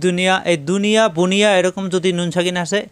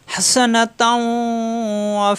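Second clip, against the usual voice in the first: Quranic recitation; 3 semitones higher, much slower than usual. Shown as a demonstration. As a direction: recite it as a model for students. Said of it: "থামলে আমরা কীভাবে পড়বো".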